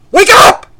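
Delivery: sudden high shout; explosive, high-pitched rallying cry; yelling. Real, not acted. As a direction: yell it out to jolt listeners awake. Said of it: "They don't like me yelling".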